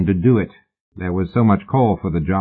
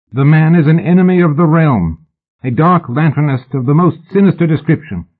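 louder, urgent and alarmed; alarmed warning; 7 semitones higher, 5 dB louder than usual. A narrator's reading. Mallory urges the unbothered king, loud and alarmed. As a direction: warn him of a dangerous enemy.

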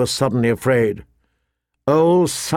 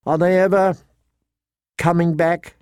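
put-on higher, tentative child's question; anxious child; 5 semitones higher than usual. Boy in bed asking his brother, anxious. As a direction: ask haltingly in a higher voice.

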